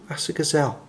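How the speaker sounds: ordinary delivery, nothing marked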